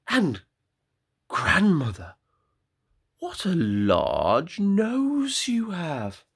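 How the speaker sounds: put-on high girl voice sinking to a slow hush on the last words; wondering little girl. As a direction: ask in awe, high then hushed. Girl questions.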